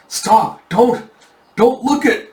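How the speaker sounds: high-pitched frantic pleading; acted alarm, high and urgent; panicked plea. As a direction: beg them to stop, pitch jumping up. Victim pleads against cursed gaze.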